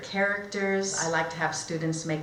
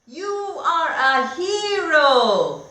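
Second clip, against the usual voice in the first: higher, slow hammed-up cheer; mock shout; 10 semitones higher, 8 dB louder, much slower than usual. Animals cheering Alfred, mock shout higher and slow. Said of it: "shout the animals".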